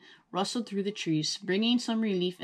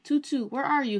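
calling out; 7 semitones higher than usual. A narrator's reading. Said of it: "he yelled".

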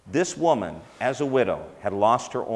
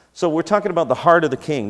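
louder, fast, rising proclamation; loud declaration; 7 semitones higher, 8 dB louder, faster than usual. Real, not acted. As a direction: boom it out to the whole room. Loud return to the sermon.

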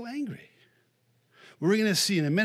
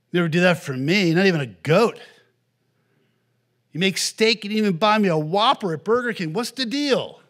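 loud, high, indignant complaint; the older brother protesting to his father, loud and resentful; 4 semitones higher, 7 dB louder than usual. Retold or imitated. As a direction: whine it like a wronged son.

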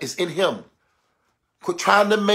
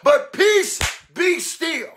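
higher, driving sermon cadence; full-force preaching; 7 semitones higher than usual. Real, not acted. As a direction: preach it in rhythmic, building repeats.

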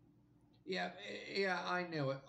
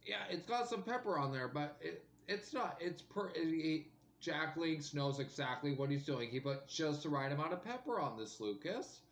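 chewing while talking; speaking around food; mouth full. Real, not acted. Seen 0: bites jerky at +2.0, then chews and holds piece while talking.

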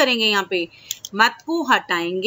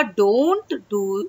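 slow drawn-out demo; 2 semitones lower, much slower than usual. Shown as a demonstration. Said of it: "जिसे English में बोला जाएगा".

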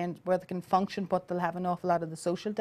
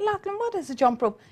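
much higher, mildly baffled mother; puzzled question; 12 semitones higher than usual. Retold or imitated. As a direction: ask it as if you've never heard the word. Mother puzzled by son's word.

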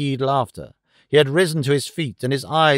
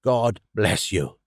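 put-on low, slow old man's blessing; old man, moved; 6 semitones lower, slower than usual. A narrator's reading. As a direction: draw the blessing out, low and heartfelt. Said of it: "he cried".

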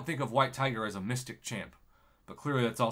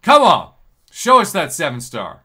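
loud, pleading cheer at the screen; loud urging; 3 semitones higher, 14 dB louder than usual. Real, not acted. Begging the crystal for a seven star, loud. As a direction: shout at the crystal to deliver.

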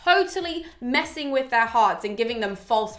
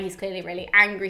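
ordinary delivery

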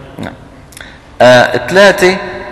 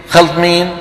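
usual speaking voice

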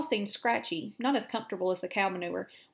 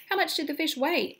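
eager; 7 semitones higher than usual. Acted. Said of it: "asked Wilbur eagerly".

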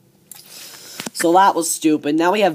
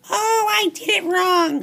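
mock whiny excuse; 14 semitones higher than usual. Mocking John Cena's excuses.

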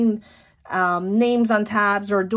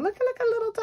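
cute squeal; 12 semitones higher, 4 dB quieter, faster than usual. Real, not acted. Pointing out tiny detail.

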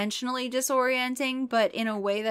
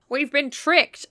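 louder, slow angry cry; a mock-angry father, louder; 6 semitones higher, 6 dB louder, slower than usual. A narrator's reading. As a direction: spit out the betrayal. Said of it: "Dad cry angrily".

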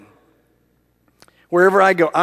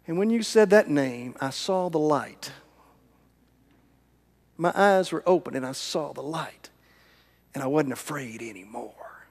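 softer and lower, fading to a whisper; hushed testimony; 4 semitones lower, 9 dB quieter than usual. Retold or imitated. Girl telling of seeing the light, fading into a whisper. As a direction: let it sink to a hushed whisper.

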